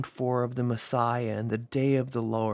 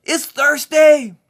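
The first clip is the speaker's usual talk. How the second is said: loud proclaiming yell; 11 dB louder than usual. Acted. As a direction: cry it out to the whole temple crowd. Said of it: "he yelled in a loud voice".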